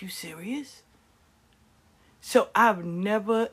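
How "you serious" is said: whispering. Retold or imitated. Weary reply to kids.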